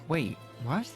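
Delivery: ordinary talk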